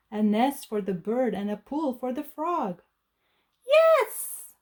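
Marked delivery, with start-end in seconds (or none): 3.7-4.5 high, loud triumphant cry; much higher, louder joyful yes; witch cries yes. Acted. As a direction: cheer in delight. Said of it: "cried the witch".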